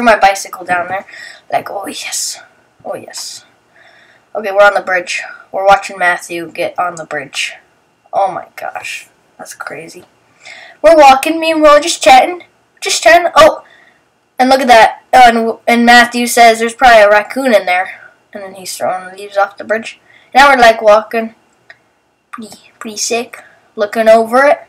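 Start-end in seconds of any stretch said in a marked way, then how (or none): 1.5-3.4 whispered. Real, not acted. Quiet thrilled aside on bike. 22.3-23.4 whisper. Real, not acted. Walking on bridge, hushed remark.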